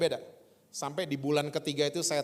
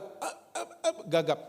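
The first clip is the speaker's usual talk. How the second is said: stutter demo. Shown as a demonstration. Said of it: "Dan itu pun gagap".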